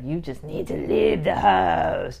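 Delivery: slow, stern mock command; stern order. To family not helping with cooking, slow stern order. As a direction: lay down the law to the family. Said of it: "I get turned into this".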